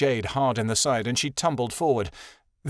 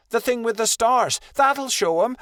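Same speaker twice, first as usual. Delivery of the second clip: high, louder, bursting with excitement; eager pup; 11 semitones higher, 4 dB louder than usual. A narrator's reading. Young wolf hyping the star power, eager and high. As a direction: cheer it on like a giddy youngster.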